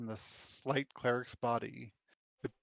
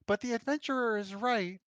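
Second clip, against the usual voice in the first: higher, lightly put-on druid voice; Roy's voice; 10 semitones higher than usual. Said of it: "discovered whose voice it is".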